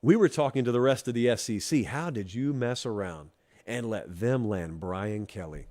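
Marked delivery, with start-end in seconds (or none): 1.8-5.7 hushed knowing aside. Retold or imitated. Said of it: "when we said it".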